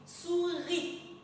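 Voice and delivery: "souris" slowed into "sou" and "ri"; syllable by syllable. Shown as a demonstration. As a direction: dictate "souris" in two drawn-out beats. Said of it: "on le lit syllabe par syllabe".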